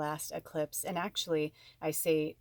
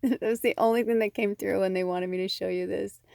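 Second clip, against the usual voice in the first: ordinary delivery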